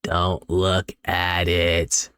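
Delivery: very slow, even creepy warning; eerie monotone. Acted. Possessed girl gives only this response, eerie monotone. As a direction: intone it word by word, ghostlike.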